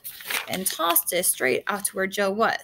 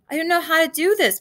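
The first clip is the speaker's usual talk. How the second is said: raised, higher-pitched shout; 7 semitones higher, 5 dB louder than usual. A narrator's reading. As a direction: shout it over the storm. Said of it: "Buzz shouted".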